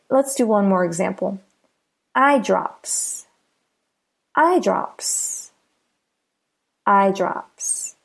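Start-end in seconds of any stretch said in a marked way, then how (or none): 2.1-7.9 "eye drops" with "eye" emphasized, "drops" weaker; careful model of "eye drops", first part stressed. Shown as a demonstration. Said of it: "the stress is on the first part".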